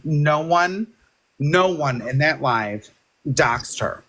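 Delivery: ordinary talk, nothing marked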